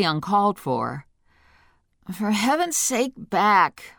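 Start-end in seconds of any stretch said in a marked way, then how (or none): 2.1-3.9 sleepy, groggy mumble. Acted. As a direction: sound half asleep and groggy. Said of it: "I said in a groggy voice".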